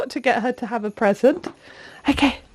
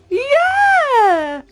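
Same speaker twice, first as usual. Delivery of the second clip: excited cheer; 10 semitones higher than usual. Real, not acted. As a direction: celebrate her right answer. Toddler names the mouse.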